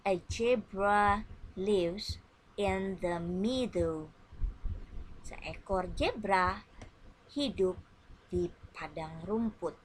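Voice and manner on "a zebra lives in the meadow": slow model sentence. Shown as a demonstration. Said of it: "Listen and repeat after me".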